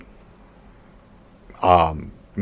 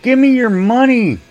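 much higher, whiny pleading for cash; mock begging; 9 semitones higher than usual. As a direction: beg the viewers jokingly in a high voice. Mock begging viewers for money.

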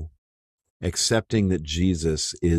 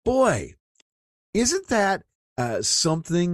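much higher, emphatic burst; excited exclamation; 11 semitones higher than usual. Real, not acted. Preacher to congregation, suddenly excited. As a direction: jump up in real amazement.

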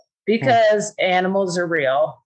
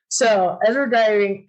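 usual speaking voice

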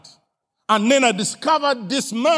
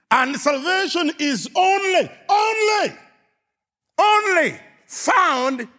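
preaching shout; 7 semitones higher than usual. Acted. Preacher building to climax.

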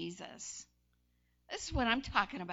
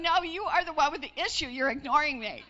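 louder, fast, heated comeback; mock indignant shout; 3 semitones higher, 10 dB louder, faster than usual. Wife firing back at husband mid-argument. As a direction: fire it back at him. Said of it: "And I'm going".

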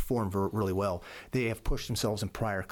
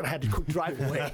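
higher, laughing while telling it; 5 semitones higher than usual. Real, not acted. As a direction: laugh at his own tears. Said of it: "tearing up and starting to cry".

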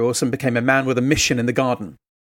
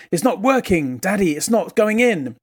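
put-on higher, childlike small-boy voice; a young child's voice, higher pitched; little boy; 5 semitones higher than usual. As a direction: play the toddler complaining to Daddy. Small son voiced in story.

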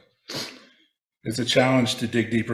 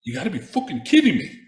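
put-on higher, surprised Irish accent; 6 semitones higher than usual. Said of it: "in a perfect Irish accent".

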